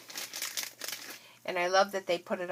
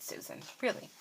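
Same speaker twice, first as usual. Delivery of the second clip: muttered self-scolding; 5 semitones higher, 6 dB quieter than usual. Real, not acted. Scolding herself for the wrong date.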